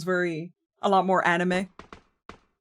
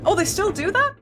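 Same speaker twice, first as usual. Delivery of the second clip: surprised; 12 semitones higher, 3 dB louder than usual. Real, not acted. Learns from chat, reacts surprised.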